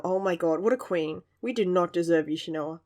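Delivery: normal talking voice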